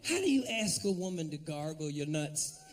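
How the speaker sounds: ordinary delivery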